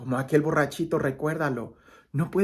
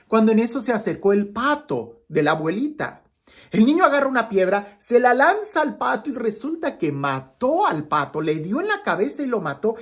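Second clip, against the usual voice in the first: animated parable telling, voice lifted; preacher spinning a story, brighter and louder; lively storytelling; 3 semitones higher, 4 dB louder than usual. Acted. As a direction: tell the tale with rising excitement. Tells a parable to viewers.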